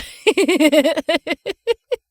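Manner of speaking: high, loud unhinged laugh; maniacal laugh. Acted. Girl laughing at the bully's talk of prayer, maniacal laugh. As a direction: cackle like a mad scientist. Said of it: "A laugh escaped my lips, sounding maniacal".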